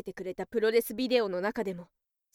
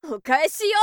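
loud, higher payback cry; fierce shout; 4 semitones higher, 8 dB louder than usual. Acted. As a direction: hurl it at him as you strike. Striking back in the fight.

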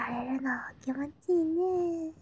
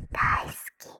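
whispered confession. Acted. Little sister confessing to her brother, whispered. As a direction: let 大好き slip out in a hushed whisper. Repeats daikuki, soft then loud.

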